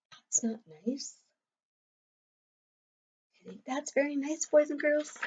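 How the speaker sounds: hushed, breathy disapproval; whispered aside. Real, not acted. Teacher whispers to the children during the story, disapproving. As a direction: drop to a whisper to the class. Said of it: "whispered aside to class".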